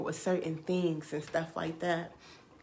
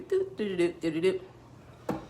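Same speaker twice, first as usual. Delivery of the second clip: little doop-doop tune noises; playful nonsense scatting; imitated car music. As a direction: mimic a bouncy tune. Imitates music while driving.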